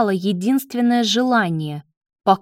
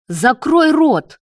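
higher, louder, slow angry order; furious command; 6 semitones higher, 5 dB louder, slower than usual. A narrator's reading. Leo lunges at Marat, furious. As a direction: shut him up angrily.

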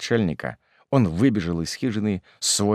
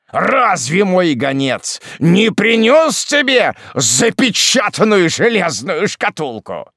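high, loud, slow interrogation; incensed roar; furious demand; 12 semitones higher, 8 dB louder, slower than usual. Acted. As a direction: shout the question, hammering each word. Hermit demands answer.

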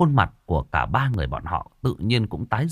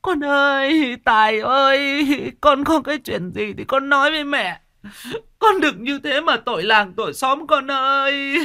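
very high keening lament; mother wailing over her son's coffin; wailing grief. Acted. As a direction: wail to the dead son, voice breaking. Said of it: "vật vã khóc lóc".

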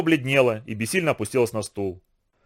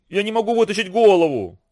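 moaning; 6 semitones higher than usual. A narrator's reading. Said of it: "простонал Лазарь".

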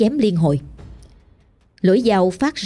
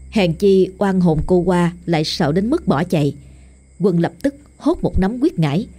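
usual speaking voice

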